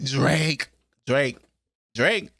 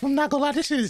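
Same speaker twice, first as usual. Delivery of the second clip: hyped; 10 semitones higher, faster than usual. Real, not acted.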